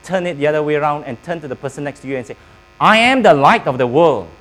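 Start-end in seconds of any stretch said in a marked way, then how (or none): 2.8-4.3 proud, loud declaration; loud confident. Real, not acted. Congregation answering the preacher, loud and proud. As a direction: say it out loud with pride. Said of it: "Wow, that sounds better".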